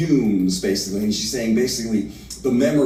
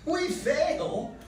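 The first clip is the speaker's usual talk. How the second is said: much higher, mocking disbelief; scoffing laugh-through; 6 dB quieter than usual. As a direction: laugh off his doubt and egg him on. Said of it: "Ellen Terry did it with a laugh".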